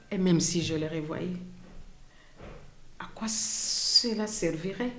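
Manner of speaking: softly spoken survivor's testimony, long pauses between phrases; témoignage déclamé. Acted. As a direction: recount it quietly as the woman who lived it. (témoignage dit à voix basse, avec retenue)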